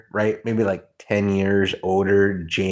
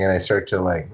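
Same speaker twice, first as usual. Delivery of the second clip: usual speaking voice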